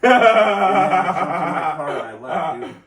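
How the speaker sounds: laughing. Real, not acted.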